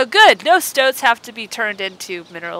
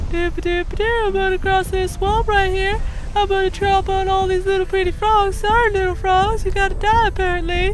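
higher, goofy friendly giant voice; Jenna Marbles voice; 8 semitones higher than usual. Acted. The mountain giant greeting villagers in a high goofy Jenna Marbles voice. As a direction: play the giant as a cheery high doof. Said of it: "I sound like Jenna Marbles".

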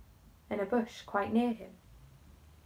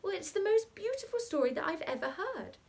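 put-on higher, firm small girl; a hint of a decided girl; decided little girl; 9 semitones higher than usual. A narrator's reading. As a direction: declare it with great certainty. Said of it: "with immense decision".

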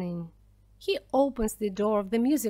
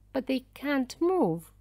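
usual speaking voice

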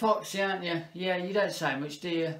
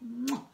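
kiss sound; 7 semitones higher, 4 dB quieter than usual. Acted. Kiss for Mister Giraffe, a smacking air kiss. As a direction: blow a big kiss.

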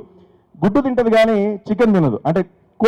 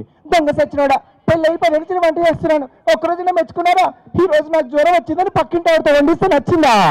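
shrill scolding wife; 10 semitones higher than usual. Retold or imitated. Wife scolding husband after pouring sambar.

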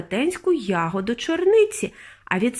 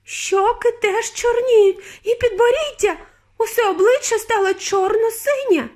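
very high, frantic mother; hysterical wailing; 12 semitones higher, 4 dB louder than usual. A narrator's reading. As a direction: keep shrieking as the horror grows. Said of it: "лементувала пані Борогар".

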